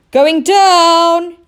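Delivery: high loud yell. Acted. Oscar lowering the net, yelling loud and high. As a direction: yell it down to the dock. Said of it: "he yelled".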